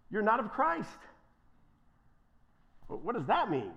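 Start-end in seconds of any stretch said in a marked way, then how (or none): none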